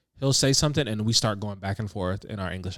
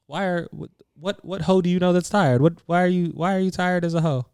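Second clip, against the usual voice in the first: higher, mock-baffled questioning; puzzled, pitched-up questions; imitating baffled questioners; 6 semitones higher than usual. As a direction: mimic people who can't make sense of it. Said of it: "everybody was like".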